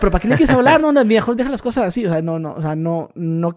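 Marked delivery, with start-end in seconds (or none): 0.0-2.0 higher, hurried pleading excuses; pleading boyfriend. Retold or imitated. Boyfriend pleading with his girlfriend to believe him, higher.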